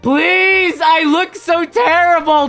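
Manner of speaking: high, loud hammed-up wail; retold mortified pleading. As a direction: plead melodramatically, drawing the words out. Mock pleading with fans at hotel.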